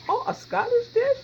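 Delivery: high, loud, amazed question; mock surprise. Cook pretends to be amazed by her own fact, hammed-up surprise. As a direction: gasp it back as if astonished. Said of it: "I'm just kidding".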